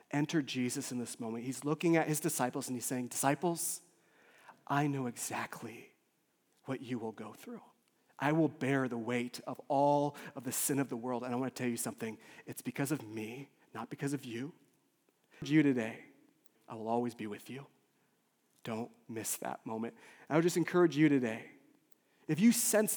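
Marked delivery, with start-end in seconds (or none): 3.1-14.5 soft, low, measured assurance; tender, hushed solemnity; Jesus consoling disciples. Acted. Voices Jesus consoling his disciples. 16.7-19.9 soft, low reassurance; hushed, sincere comfort; tender promise. Acted. Tender promise, then soft appeal.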